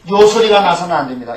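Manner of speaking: usual speaking voice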